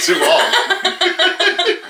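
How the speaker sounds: laughing. Real, not acted. Laughing while agreeing.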